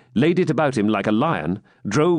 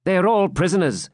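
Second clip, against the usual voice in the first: higher, light-toned jester; 8 semitones higher than usual. A narrator's reading. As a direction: play it as the wry jester. Jester voiced in story.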